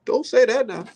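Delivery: Roy Jones impersonation. Retold or imitated. Streamer impersonating Roy Jones on commentary, high fretful voice. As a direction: whine it high like a worried elder. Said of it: "That's my Roy Jones impersonation".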